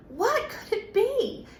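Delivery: high laughing wonder; bubbling with excitement; excited. Acted. Excited mystery question.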